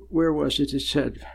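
ordinary talk, nothing marked

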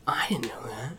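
hushed mock surprise, deadpan; quiet, dry sarcasm; sarcastic. Real, not acted. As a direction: feign ignorance, very softly and sarcastically. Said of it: "I'm being completely sarcastic".

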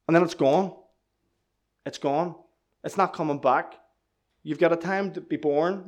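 ordinary talk

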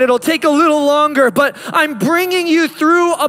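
full-force preaching. Acted. Pastor driving home God's message, climactic preaching. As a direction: lift it up and pour it out over the room.

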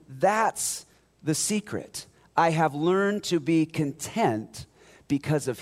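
loud, high, building sermon; full-force preaching. Acted. Pastor proclaiming Paul's secret to the church, full-force preaching. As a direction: preach it out to the back row.

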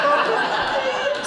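high playful yell; mock yell back. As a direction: cheer it like a shared joke. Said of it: "she does it too. She goes".

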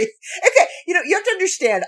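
high, fast, through laughter; can barely talk for laughing; giggling explanation. Real, not acted. Laughing fit.

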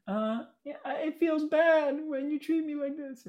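put-on high, slow little-kid voice reciting his line; a small boy saying a rehearsed line; coached child line. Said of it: "coached me ahead of time to be like".

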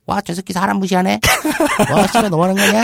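high laughing alien sneer; cackling mocking alien. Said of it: "외계인 목소리". (웃음 섞인 외계인 목소리)

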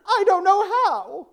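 put-on high, slow, loud helpless kid voice; little girl voice. Sally tells the teacher she can't get up, high and drawn out. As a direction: wail it like a lost little girl.

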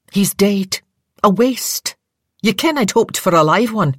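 Scottish nurse writing off the soldier; Scottish dismissal. Acted. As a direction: brush him off in a Scottish lilt. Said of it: "a Scottish voice demanded".

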